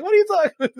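high put-on disbelief; exasperated. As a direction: imitate an exasperated comic, pitch shooting up. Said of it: "like, exasperated".

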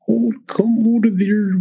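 high, drawn-out go at Scottish; attempted Scottish accent. Podcaster trying a Scottish accent on request, playful attempt. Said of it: "anybody wanna attempt this, uh, Scottish accent".

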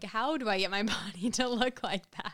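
laughing through the question; giggly disbelief. Retold or imitated. Quoting herself, laughing.

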